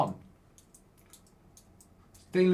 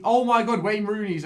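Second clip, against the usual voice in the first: surprised; 7 semitones higher, 6 dB louder than usual. Real, not acted. Spots Rooney at Blackpool.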